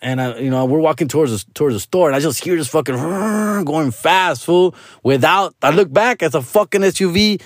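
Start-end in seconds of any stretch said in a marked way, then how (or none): 3.0-3.6 vrooming car noise with the mouth; imitated engine roar. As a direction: make a revving, rushing car sound. Imitating the speeding car's engine.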